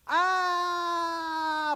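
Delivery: long high scream cut short by impact; falling scream. Retold or imitated. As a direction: scream all the way down. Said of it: "falling out fifteen stories goes".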